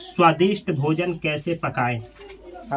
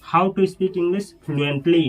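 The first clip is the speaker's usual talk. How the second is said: slow model sentence; much slower than usual. Shown as a demonstration. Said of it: "तो ऐसे बोलेंगे".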